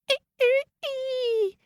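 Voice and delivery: very high squealing ee ee; high squeals. Acted. The hen squealing at the peddler, very high. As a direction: squeal like a pig. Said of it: "said the hen".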